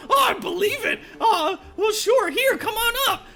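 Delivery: high loud goblin, impressed; high goblin wowed by a bluff; amazed goblin. Acted. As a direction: gasp in awe at the newcomer. Goblin invites player up.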